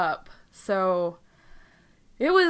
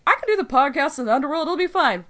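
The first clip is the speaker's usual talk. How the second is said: higher, quick, mock-confident self-quote; breezy overconfidence; 5 semitones higher, 5 dB louder, faster than usual. Podcaster quoting own naive plan for the underworld, breezily overconfident. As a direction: toss it off fast and high, sure it'll work.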